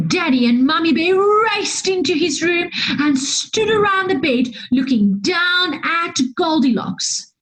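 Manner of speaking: plain reading voice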